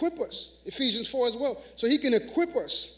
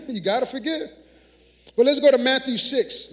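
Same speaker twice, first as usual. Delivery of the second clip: ordinary delivery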